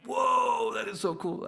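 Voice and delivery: high, loud wide-eyed whoa; amazed awe. Retold or imitated. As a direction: gasp out delighted wonder. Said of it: "I was like".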